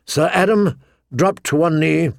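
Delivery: ordinary delivery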